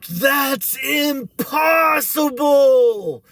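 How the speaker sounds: high, loud, drawn-out wail; re-enacted anguished protest; hopeless outcry. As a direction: cry it out in despair, stretching the words.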